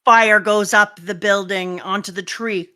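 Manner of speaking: normal talking voice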